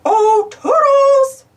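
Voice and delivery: long, high, loud call. Acted. Mickey calling for Toodles, long high loud call. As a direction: sing out the name slowly. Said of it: "said Mickey".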